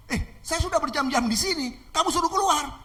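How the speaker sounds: acted outrage at being sent away; angry attacker. Man protesting the girl's order to leave, angry. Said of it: "Marah-marah ini si".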